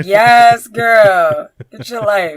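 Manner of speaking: cheering. Retold or imitated. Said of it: "I'm like".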